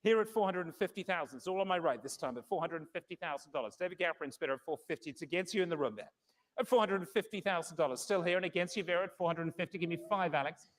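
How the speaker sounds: brisk, measured bid calling; fine art auctioneer. Acted. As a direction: call the bids steadily, room to room.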